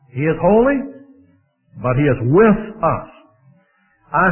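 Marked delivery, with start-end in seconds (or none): none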